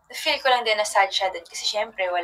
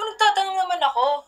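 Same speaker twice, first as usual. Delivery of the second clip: protesting; 9 semitones higher than usual. Retold or imitated. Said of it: "Sabi ko".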